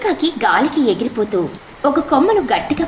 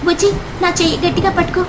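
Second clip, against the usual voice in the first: higher, hurried warning voice; urgent mother bird; 6 semitones higher, faster than usual. Acted. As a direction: rush everyone in from the storm. Mother urges all inside in storm. (తుఫానులో అందరినీ లోపలికి పిలిచే తల్లి, వేగంగా)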